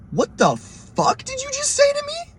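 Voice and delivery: very high, stretched-out disbelief; mock-shocked high squeal; incredulous retelling. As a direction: squeak it slowly, utterly appalled. Retelling his shock at the request.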